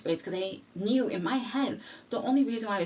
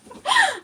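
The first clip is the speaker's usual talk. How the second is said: high, loud burst of laughter; laughing at her own excuse; shrieking laugh; 10 dB louder than usual. Real, not acted. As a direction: crack up loudly.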